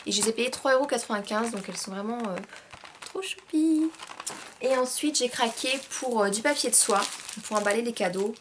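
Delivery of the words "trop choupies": cute squeal. Acted. Gushing over cute heart candles.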